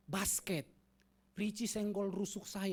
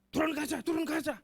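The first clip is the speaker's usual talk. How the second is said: higher, loud, fast barking order; angry demand; 10 semitones higher, 7 dB louder, faster than usual. Retold or imitated. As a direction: bark it like an angry driver.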